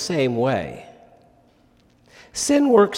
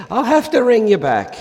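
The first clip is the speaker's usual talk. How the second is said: put-on a light, higher, louder stab at an Irish accent; hammed-up Irish pub caller declaring war; attempted Irish accent; 11 semitones higher, 5 dB louder than usual. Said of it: "needs an Irish accent".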